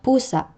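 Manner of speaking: Pusa with high stressed Pu-, abrupt catch after the a; penultimate glottal stress. Shown as a demonstration. Teacher showing glottal catch, Pusa stressed on first syllable. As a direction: stress the start of Pusa, cut the end off. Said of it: "pronounced with a glottal catch".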